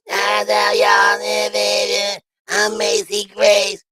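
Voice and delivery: slow, raspy high imitation of [name]; raspy [name] impression. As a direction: draw it out in a scratchy singer voice. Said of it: "that raspy voice".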